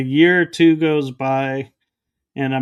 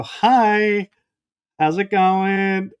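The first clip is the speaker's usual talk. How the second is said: higher-pitched, drawn-out retold hello; bright mock greeting; 5 semitones higher, much slower than usual. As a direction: greet a stranger in a raised voice. Retold greeting to stranger on phone.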